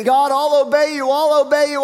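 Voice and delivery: mock pledge. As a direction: parody a showy vow to God. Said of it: "they were saying".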